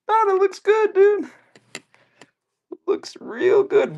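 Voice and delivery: high, squeaky fake compliment, last bit slow; fake polite praise. To the buddy about his paint job, high squeaky fake praise. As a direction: squeak out a forced compliment, stretch the end.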